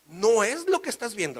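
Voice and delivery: high, loud protest; panicked denial. Acted. Caught husband excusing himself to wife, panicked denial. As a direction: plead loudly that you are innocent.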